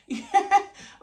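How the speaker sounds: high burst of laughter; real shrieking laugh; loud laugh. Real, not acted. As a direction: crack up loudly. Laughing at her own story.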